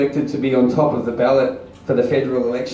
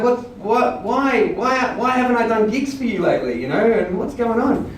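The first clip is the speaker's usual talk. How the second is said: puzzled questioning; 8 semitones higher than usual. Retold or imitated. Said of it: "And I'm like".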